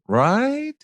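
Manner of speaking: Right? climbing high at the end; upswing tail. Shown as a demonstration. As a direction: tag the sentence with a high Right?. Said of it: "a little upswing".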